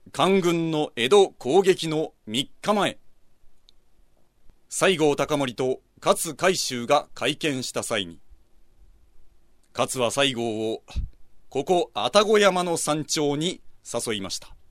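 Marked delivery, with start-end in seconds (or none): none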